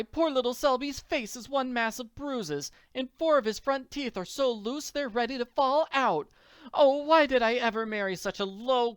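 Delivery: high-pitched, outraged wife berating her husband; indignant wife. Acted. Wife berating her husband over poor Selby's bruises, indignant. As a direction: heap high, loud scorn on your husband.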